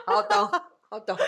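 high and loud through real laughter; amused laughing repeat. Real, not acted. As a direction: laugh the words out. Laughing at the joke.

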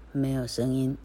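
ordinary delivery, nothing marked

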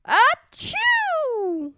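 high exaggerated sneeze; cartoon sneeze. Princess sneezes.

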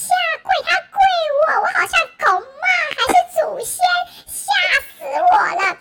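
excited disbelief. Real, not acted. Excited retelling, shock.

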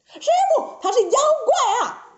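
very high pleading cry; high-pitched acted insistence; desperate protest. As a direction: cry out to be believed. Disciple protests desperately to master.

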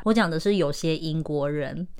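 normal talking voice

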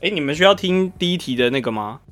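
ordinary talk

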